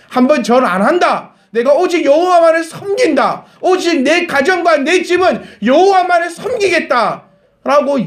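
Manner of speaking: preacher's proclamation; vow proclaimed. Acted. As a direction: declare it like an oath.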